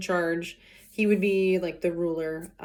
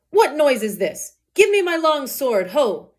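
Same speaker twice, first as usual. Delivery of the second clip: put-on demanding old man; 5 semitones higher, 6 dB louder, slower than usual. Old Capulet demands his sword.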